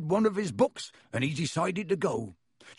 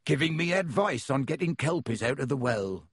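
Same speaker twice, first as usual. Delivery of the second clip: ordinary delivery, nothing marked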